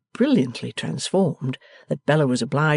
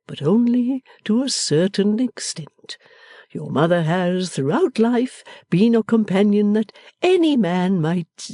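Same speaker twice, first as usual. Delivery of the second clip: lightly voiced fond father, a bit higher; a gentle old father pleased with his daughter; father answering his daughter, lightly voiced and pleased; 6 semitones higher than usual. A narrator's reading. Father praising wife.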